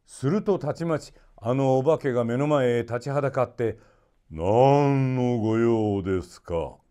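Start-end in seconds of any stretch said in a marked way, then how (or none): 4.3-6.8 genie voice. A narrator's reading. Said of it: "と聞きました".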